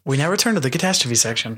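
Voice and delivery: fast mock radio announcer. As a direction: read it like a quick radio bumper.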